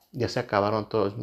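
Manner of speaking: ordinary talk, nothing marked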